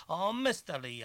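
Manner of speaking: desperate pleading. A narrator's reading. Pleading at door.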